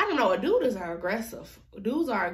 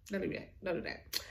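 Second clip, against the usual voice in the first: quiet warning; 3 semitones lower, 11 dB quieter than usual. Retold or imitated. Warning a rival girl off.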